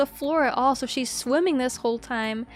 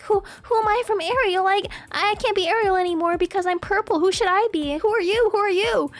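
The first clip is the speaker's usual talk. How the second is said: put-on higher, quick kid questions; a hint of a pushy child; eager little girl; 6 semitones higher, faster than usual. Imitating the girl quizzing her. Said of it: "She was like".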